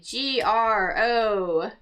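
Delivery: G R O C E R spelt slowly letter by letter; spelling out. Shown as a demonstration. Teacher spelling the crossword answer slowly. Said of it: "spelling the answer".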